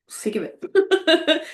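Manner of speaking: high, drawn-out mock complaint; slow, high-pitched whinge before a laugh; playfully fed up. Real, not acted. Fed up with feeling, then laughs.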